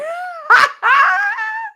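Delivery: loud high burst of laughter; loud shrieking laugh; big laugh. Real, not acted. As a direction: crack up loudly. Big laugh at own joke.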